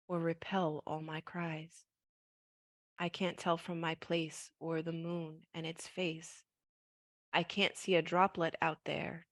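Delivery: soft, slow poetry recital; poem reading. Acted. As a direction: read it gently and slowly. Reads her poem aloud.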